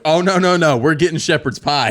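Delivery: high, loud put-your-foot-down no; loud firm refusal. Retold or imitated. Husband loudly overruling his wife's new order. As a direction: shout it down, half laughing.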